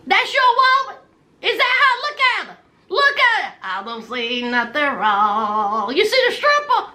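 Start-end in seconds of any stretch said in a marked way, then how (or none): 3.6-5.9 much lower, slow man's voice; Gino's low voice. Retold or imitated. Gino shrugs off the video, low slow voice. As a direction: drop way down to play Gino unbothered.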